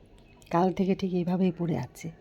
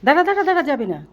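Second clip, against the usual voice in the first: urgent loud call; 8 semitones higher, 8 dB louder, faster than usual. Real, not acted. Mother stopping daughter from leaving.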